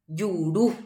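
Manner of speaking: Juru with the second U stressed; stress on final U. Shown as a demonstration. Teacher demonstrating unmarked accent, stress on final U. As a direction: lean on the last U of Juru. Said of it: "U ári hatãve ja'e".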